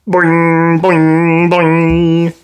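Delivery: playful sound effect; bouncy boing. A narrator's reading. Trampoline sound words.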